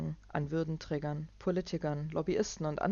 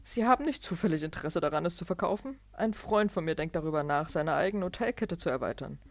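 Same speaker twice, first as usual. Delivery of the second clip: loud, higher sales pitch; pushy businessman; 4 semitones higher, 5 dB louder than usual. Acted. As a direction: fish for a cheap deal. Clark's voice.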